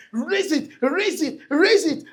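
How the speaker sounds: rising chant of raise it; building urge. Real, not acted. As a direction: climb to a loud call. Repeated urging, building.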